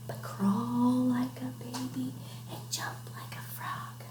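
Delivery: slow, drawn-out whisper; hushed. Pretend. Reader repeating the crawling instruction to children, slow whisper. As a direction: whisper it slowly, stretching the words. Softly repeats while acting it out.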